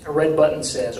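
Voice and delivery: plain reading voice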